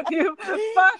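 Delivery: giggling through an imitated swear; laughing mock curse. Friend echoing the curse while laughing.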